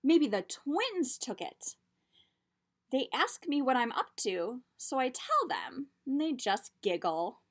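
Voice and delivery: lively storybook read-aloud; reading to children. A narrator's reading. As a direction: tell it like a picture book. Reads picture book aloud.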